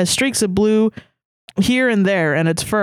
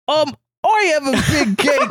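hammed-up, higher-pitched British accent; 6 semitones higher than usual. Rereading the customer's line in a mock British accent. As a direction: put on a silly British voice. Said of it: "read that in a British accent".

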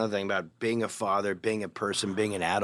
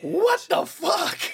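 high, slow, louder shout of outrage; imitated angry shout; 5 dB louder, much slower than usual. Five friends jump off the sofa: slow high outraged shout. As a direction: bellow it like a startled gang. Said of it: "jump up like".

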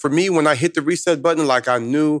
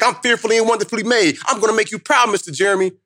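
high-pitched schoolgirl's vow, imitated; determined young girl; 9 semitones higher than usual. Student vows to her mentor, high and determined. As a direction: pitch it well up as a girl making a proud promise. Retold girl vowing to speaker.

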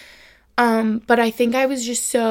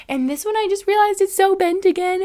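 real exasperation, pitched way up; higher, strained burst of frustration; freaking out; 9 semitones higher, 4 dB louder than usual. Real, not acted. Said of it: "I'm freaking out right now".

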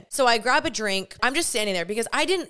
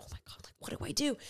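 hushed retold panic; panicked whisper; 4 semitones higher, 13 dB quieter, faster than usual. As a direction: whisper it as a secret plea for help. Silent panic toward John.

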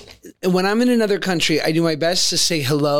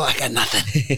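deflated admission; 8 semitones lower than usual. Retold or imitated. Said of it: "And then I go".

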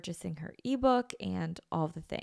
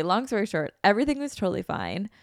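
ordinary talk

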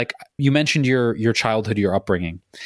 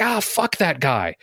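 road rage; 2 semitones higher, slower than usual. Shown as a demonstration. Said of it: "someone cuts me off in traffic".

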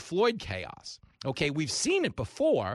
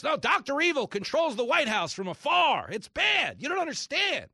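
much higher frantic shouting; hysterical media; 9 semitones higher, 3 dB louder than usual. Retold or imitated. Host mocking the media's Doctor Evil claims. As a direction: shriek like a panicked pundit. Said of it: "that's what they told us".

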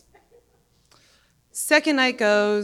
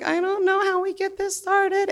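awkward voice; 10 semitones higher than usual. Retold or imitated. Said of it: "Everyone's kinda like".

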